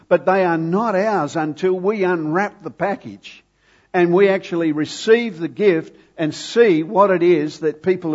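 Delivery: emphatic preaching. Real, not acted. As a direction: press the point home to the whole congregation.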